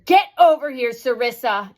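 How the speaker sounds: put-on sharp, loud mother's yell at her child; retold angry yell. Mother yelling across the store at her child. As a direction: bark it out like a fed-up mother. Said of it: "this voice yelling".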